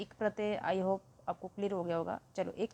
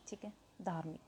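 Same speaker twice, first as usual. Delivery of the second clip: whisper; 10 dB quieter than usual. Real, not acted. Teacher drops to a hush.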